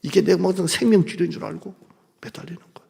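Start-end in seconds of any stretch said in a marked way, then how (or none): 2.2-2.9 breathy whispered punchline. Acted. Pastor confiding to the pews in a whisper. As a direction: drop to a whisper to land it.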